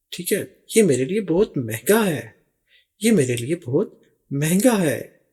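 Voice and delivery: ordinary talk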